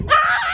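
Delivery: sudden high yell; cold shock scream. Real, not acted. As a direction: shriek at the cold. Jumping into cold hot tub.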